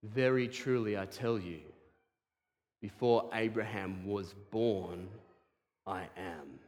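solemn hush. Acted. Jesus answering the Pharisees, low and hushed. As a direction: let I am fall quietly.

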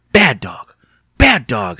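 usual speaking voice

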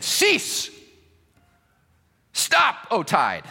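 high, ringing order to the sea; acted imperious cry, each word separate; royal command. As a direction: command the tide like a king. Acting King Canute commanding the tide.

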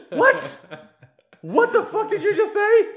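high, loud, laughing disbelief; comic outburst, voice shooting way up; incredulous shout. Acted. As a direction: shriek it in hammed-up bafflement. Acted outraged reaction to quote.

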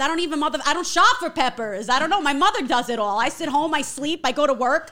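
loud, high Long Island rant; brash Long Island gal venting at her aunt; Long Island accent. Pretend. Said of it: "I want that Long Island strong".

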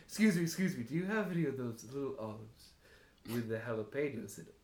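fussy patron. Acted. Stuart asks bartender for olives.

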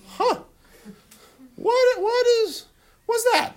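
put-on a little boy baffled by the dolls; puzzled child. A boy staring at naked dolls, high and puzzled.

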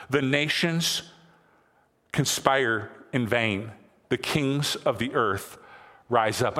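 slow, solemn reading of the psalm; solemn scripture reading. Acted. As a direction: read each phrase slowly, with weight. Reads scripture again slowly.

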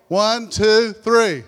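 rising shouted count to three; loud count-in. Real, not acted. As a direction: count them in, building to a shout. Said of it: "Give me your best roar".

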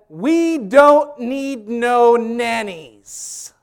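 high, booming, hammed-up professor, last words hugely stretched; imitated seminary lecturer. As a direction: declaim it loud and drawn out at the class. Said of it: "And he would say".

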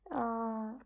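ordinary talk, nothing marked

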